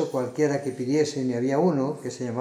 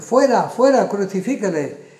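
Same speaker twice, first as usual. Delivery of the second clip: loud, high burst on the opening words, easing at the end; crowd shouting; 6 semitones higher, 6 dB louder than usual. A narrator's reading. Crowd rejecting their king before Pilato, narrated angry shout. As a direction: shout them down as the mob. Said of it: "Pero ellos gritaron".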